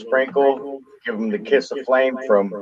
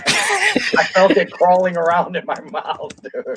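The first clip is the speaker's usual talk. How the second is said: laughing while talking; 7 semitones higher than usual. Real, not acted. Laughing while telling spider story.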